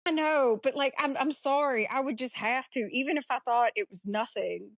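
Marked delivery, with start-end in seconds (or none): none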